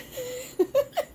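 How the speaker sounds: high cackling laugh. Real, not acted. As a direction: cackle at your own joke. Laughs after joke.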